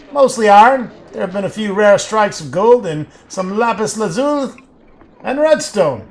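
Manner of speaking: loud, high-pitched foundry worker; shouting. A narrator's reading. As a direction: keep shouting over the noise of the works. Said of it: "He shouts".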